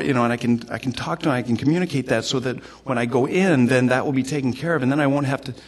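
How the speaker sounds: high, loud, hurried pleading; talking fast and high to justify yourself; hurried excuses. Acted. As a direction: rattle out justifications to the Lord without pausing. Voicing own excuses to the Lord.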